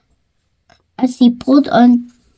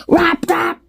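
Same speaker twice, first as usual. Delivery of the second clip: knocking sound; 6 semitones higher than usual. A narrator's reading. Wolf knocking at door.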